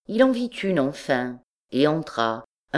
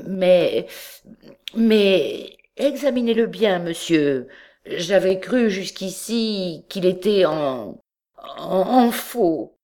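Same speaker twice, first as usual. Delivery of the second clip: higher, halting, slow bewilderment; stunned stammer; 3 semitones higher, slower than usual. A narrator's reading. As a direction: stammer out his confusion. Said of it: "perclus d'étonnement".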